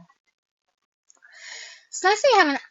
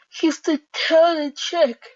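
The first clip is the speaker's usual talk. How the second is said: put-on character voice; 7 semitones higher, 7 dB louder, slower than usual.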